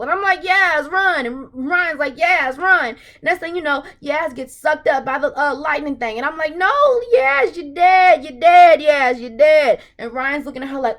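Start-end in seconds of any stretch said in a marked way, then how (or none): 0.4-1.3 high loud cry to run; shouted warning. Retold or imitated. Warning Yaz to run, a high loud shout. 2.1-2.9 mock shout. Ryan's warning retold. 6.6-9.8 slow, very loud wailing shout; anguished cry. Retold or imitated. Mourning Yaz on screen, a slow loud wail. Anguished reaction to character death.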